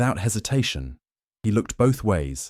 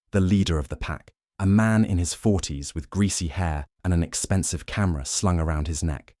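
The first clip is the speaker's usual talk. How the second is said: plain reading voice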